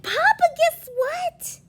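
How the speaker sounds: high, eager and loud; bursting to share the news; excited. Acted. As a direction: daughter calling her papa with big news, thrilled. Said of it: "Your mother laughed and laughed".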